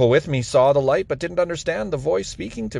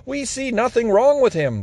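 raised, much higher protest; shouting; 8 semitones higher than usual. A narrator's reading. Pharisees defending Paul to the council, higher raised shout. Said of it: "they shouted".

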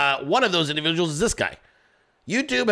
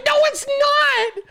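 much higher, louder mock shriek, slow; imitating her scream; 4 dB louder, slower than usual. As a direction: squeal like the outraged girlfriend. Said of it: "She's like".

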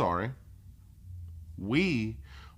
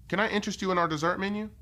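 upselling cashier; 8 semitones higher, faster than usual. Shown as a demonstration. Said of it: "repeated model line".

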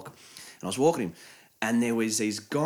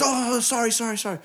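high, hurried startled apology; mock startled stranger apologising; startled guy; 12 semitones higher, 3 dB louder than usual. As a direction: squeak out a panicked sorry.

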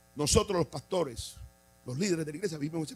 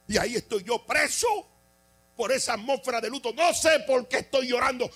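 higher, louder sung preaching; preaching at full force; 7 semitones higher, 5 dB louder than usual. Acted. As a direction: cry it out like a revival preacher.

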